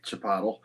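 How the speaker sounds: Chipotle said as "chuh-POT-ul"; ironic mispronunciation. Shown as a demonstration. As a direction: rhyme Chipotle with bottle. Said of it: "I started saying Chipotle ironically".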